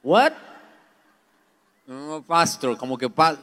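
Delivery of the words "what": sudden high, loud what; surprised what. Retold or imitated. Doctor not catching the word, loud surprised what. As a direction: snap back not understanding. Doctor can't understand him.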